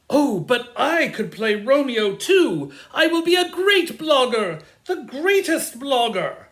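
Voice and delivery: loud, high, over-excited ham; hammy, booming enthusiasm; eager boasting. Acted. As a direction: push yourself forward for the starring part. Bottom begs for another role.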